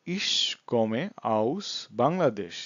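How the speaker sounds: very slow, word-by-word German; slow language demo. Shown as a demonstration. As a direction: space each word out for learners. Teacher repeats the model answer. (শব্দে শব্দে খুব ধীরে)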